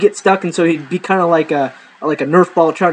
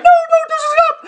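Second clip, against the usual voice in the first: shrill high squeal of protest; squealing girlfriend; 3 dB louder, much faster than usual. Retold or imitated. Girlfriend being tickled squeals in a high voice.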